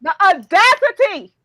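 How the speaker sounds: loud, slow and indignant. Real, not acted. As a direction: drag out the outrage. Outraged reaction to Chris.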